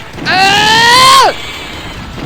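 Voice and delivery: high loud yelled "ah"; gamer's exasperated shout; frustrated scream. Real, not acted. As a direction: let out a cry of defeat. Swarmed by bats in game.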